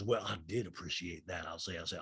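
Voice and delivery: low, soft put-on voice; Sutton. Castmate conceding a point to Erica, low and soft imitation. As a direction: drop it low and quiet, mock-polite. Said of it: "And Sutton goes".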